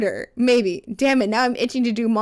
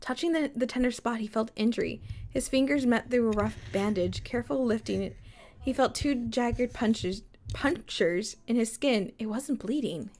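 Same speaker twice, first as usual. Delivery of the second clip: story narration; 7 dB quieter than usual. Acted. Reads story aloud.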